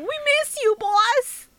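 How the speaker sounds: minion impression. Sidekicks in the back calling their boss, high whiny voice. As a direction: squeak it like a needy little minion begging. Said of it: "constantly going".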